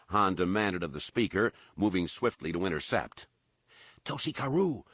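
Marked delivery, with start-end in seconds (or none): 4.1-4.8 high yelled name. A narrator's reading. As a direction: call it out to the sky. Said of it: "the albino yelled again".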